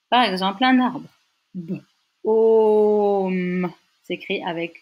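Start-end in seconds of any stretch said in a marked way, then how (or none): 1.5-3.7 "B, O, M" as one word with the O held long. Shown as a demonstration. Teacher giving Dutch for a tree, long vowel stretched. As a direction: draw out the "O" between "B" and "M". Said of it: "un son long".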